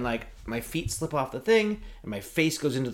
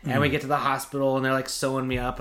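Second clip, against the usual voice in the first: ordinary talk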